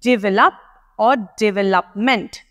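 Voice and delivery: develop and development said slowly, side by side; slow contrast develop or development; develop versus development. Shown as a demonstration. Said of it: "Either you can say it".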